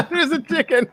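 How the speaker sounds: high-pitched, delighted outburst through a laugh; laughing, very high exclamation; excited squeal. Real, not acted. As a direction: squeal it as if thrilled by the sighting. Spots a chicken, excited outburst.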